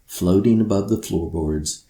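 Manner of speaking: plain reading voice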